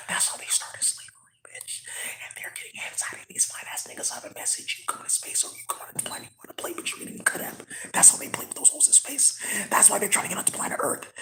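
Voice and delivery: hushed, rapid whisper. Real, not acted. Telling how they get onto planet Earth, whispering. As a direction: whisper it urgently, without voice.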